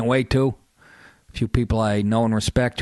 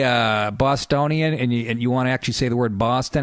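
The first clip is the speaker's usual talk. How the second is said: ordinary delivery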